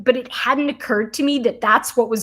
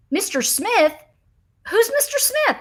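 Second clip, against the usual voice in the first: baffled; 11 semitones higher than usual. Retold or imitated. Retelling the call, baffled by the account.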